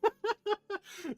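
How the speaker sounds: sudden high burst of laughter; high-pitched laugh. Real, not acted. Laughing at the mix-up.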